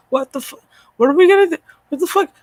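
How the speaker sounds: high, loud disbelieving outburst; exasperated yell. Real, not acted. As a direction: explode in disbelief. Ranting at the Star Trek panel.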